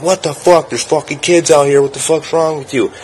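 high-pitched, indignant outburst; outraged protest. Retold or imitated. Neighbor to gunman near kids, high outraged protest. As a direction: imitate an appalled man scolding, pitch jumped way up.

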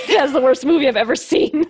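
real laughter breaking into the line; laughing. Real, not acted. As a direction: crack up while delivering a damning verdict. Cracking up at the awful movie.

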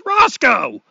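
father yells at the dog; yelling the dog's name. Acted. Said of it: "And the guy yells".

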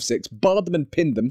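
ordinary delivery

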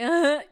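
loud imitated crying wail; mock sob. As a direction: ham up a drunk sob.

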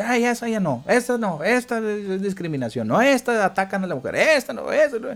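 high, fussy voice rejecting film after film; mock censor. Mocking an imagined censor who rejects every film, in a high fussy voice. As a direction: play a prissy censor waving each one away.

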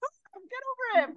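high, fast, whiny hurry-up; impatient self-quote. Retold or imitated. To herself about lingering sadness, high impatient whine. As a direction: rush yourself along, exasperated. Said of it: "I'm like".

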